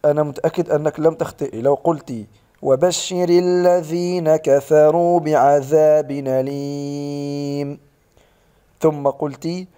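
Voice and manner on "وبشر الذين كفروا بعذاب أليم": recitation demo with stop. Shown as a demonstration. Teacher shows stopping at the verse end, chanted. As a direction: recite بعذاب أليم slowly and stop on it. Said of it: "لو توقفت".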